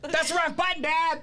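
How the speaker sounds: put-on high kid voice, laughing; little kid. The young son correcting his dad, high kid voice. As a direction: pipe up like a bossy little kid. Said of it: "another little nine-year-old, ten-year-old kid".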